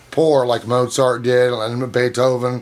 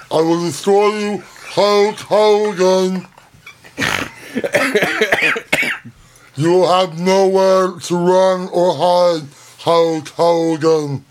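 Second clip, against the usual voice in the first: slow, higher wrestler promo voice; Andre the Giant voice; 5 semitones higher, much slower than usual. Retold or imitated. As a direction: drag out each word like a giant wrestler. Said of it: "Andre the Giant?".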